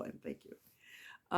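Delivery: breathy whispered thank you. Real, not acted. Thanking the friend who donated, whispered and tender. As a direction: whisper it, deeply moved.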